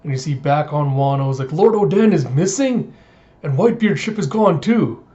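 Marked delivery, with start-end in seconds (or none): 1.5-5.0 higher, louder mock disbelief; shocked outcry. Townsfolk finding their lord missing, shocked. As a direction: exclaim it as startling news.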